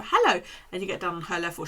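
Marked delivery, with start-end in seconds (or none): 0.0-0.4 higher, louder friendly hello; greeting the dog. Retold or imitated. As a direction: greet a dog down at her level. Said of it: "if you go to".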